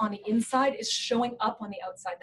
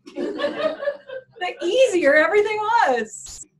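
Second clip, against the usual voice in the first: laughing; 11 semitones higher, 6 dB louder, much slower than usual. Real, not acted. Says it laughing, slow.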